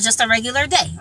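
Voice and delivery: ordinary delivery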